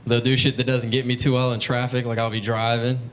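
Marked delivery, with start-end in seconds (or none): none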